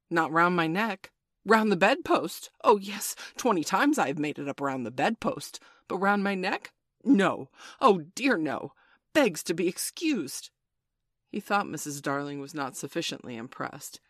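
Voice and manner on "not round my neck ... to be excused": mock the tie with exaggerated swoops; dangerously sarcastic. A narrator's reading. Father ranting about his tie to his wife, dangerously sarcastic. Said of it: "He became dangerously sarcastic".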